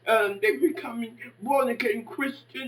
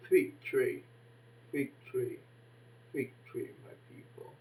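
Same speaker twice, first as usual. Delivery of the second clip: fading benediction; 11 semitones lower, 10 dB quieter than usual. Acted. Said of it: "closing benediction".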